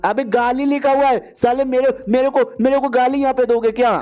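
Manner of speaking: high-pitched indignant outburst; worked-up, stammering protest at an insult; outraged. Acted. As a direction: sputter with offence, pitch jumping up. Acted outrage at reading an insult.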